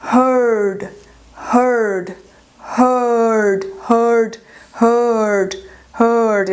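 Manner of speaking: "heard" with a long rounded vowel; teacher modelling "heard" for students, long vowel. Shown as a demonstration. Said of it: "ele é duplo, porque ele é longo".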